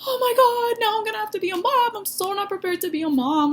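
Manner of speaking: replaying sheer panic in her head; shrill burst of alarm at becoming a mom; panicked inner voice. Panicking inner voice, unplanned pregnancy.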